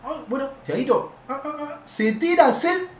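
ordinary delivery

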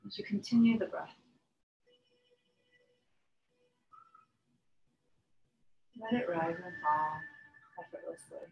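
calm yoga breathing guidance with long pauses; unhurried meditation voice, letting each phrase settle; guided meditation. Real, not acted. Yoga teacher settling students into breath and relaxation, guided meditation.

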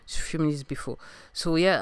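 normal talking voice

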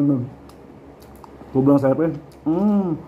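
chewing while talking; speaking between bites; mouth full. Real, not acted. Seen 4: holds pineapple slice, bites it at 1.3-1.8, then chews.

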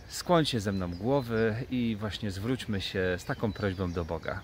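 ordinary talk, nothing marked

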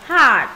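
"Heart" with a long, drawn A. Shown as a demonstration. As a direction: stretch the vowel in "Heart". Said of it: "âm A dài".

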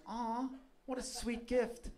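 high, slow mock-sweet aww. Bystanders cooing over the gift, high and slow. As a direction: coo it like a touched bystander. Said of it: "actually like".

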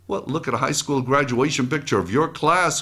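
normal talking voice

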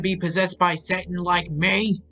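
high-pitched angry challenge. A narrator's reading. Possessed old man threatens the boy, angry. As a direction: throw the question out in anger. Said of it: "Nevercracker asked angrily".

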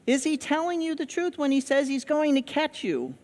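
normal talking voice

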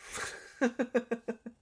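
a long, high, breathy giggle; giggle, charmed by them. Acted. Charmed by the shy patient, a high giggle. Amused by the listener's shyness.

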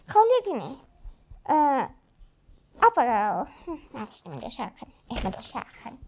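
usual speaking voice

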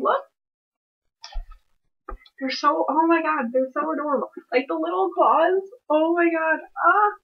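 high, delighted gushing; adoring gush. Real, not acted. Gushing over the little claws.

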